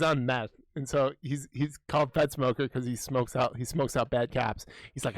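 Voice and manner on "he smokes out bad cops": usual speaking voice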